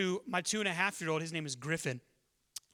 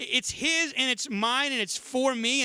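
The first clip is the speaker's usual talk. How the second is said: lightly voiced insistent child, higher and louder; possessive toddler; 6 semitones higher, 5 dB louder, slower than usual. Dad voicing his son's claim on the milkshake, lightly childlike insistence.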